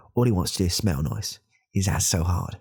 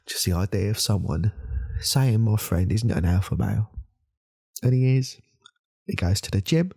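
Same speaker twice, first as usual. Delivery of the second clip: higher, indignant build; getting emotional; 5 semitones higher than usual. Acted. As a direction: work yourself up, pitch climbing. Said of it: "I'm getting a bit emotional".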